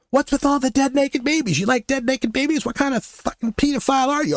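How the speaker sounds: high-pitched mock tirade of accusations; retold shouting. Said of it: "I started shouting at him".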